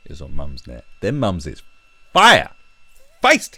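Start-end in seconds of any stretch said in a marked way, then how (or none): 2.1-3.6 excited yelp. Real, not acted. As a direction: squeal it, very high and loud. Hyping up Mumsnet mums.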